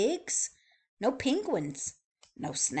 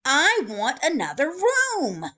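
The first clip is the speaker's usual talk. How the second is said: fuming; 7 semitones higher, 7 dB louder than usual. Acted. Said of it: "Mister Snore fumed".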